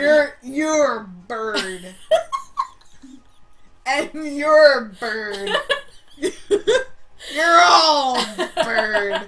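giggling through each word, slowed; cracking up while pointing out each one; laughing hard. Real, not acted. Laughing naming everyone bird.

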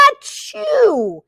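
a big high achoo; high sneeze. Acted. Reading to a child, the cow sneezes.

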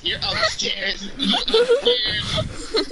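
laughing, drawn-out accusation. Real, not acted. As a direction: laugh through the words.

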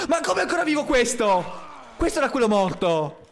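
can't believe it's alive; shocked outrage. Real, not acted. Dead zombie got up.

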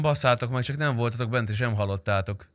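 ordinary talk

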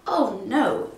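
ordinary delivery, nothing marked

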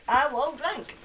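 defiant line breaking into a laugh; laughing defiance. Real, not acted. Host to chat about drinking whiskey, laughing. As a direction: laugh it off, daring anyone to object.

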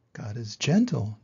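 ordinary delivery

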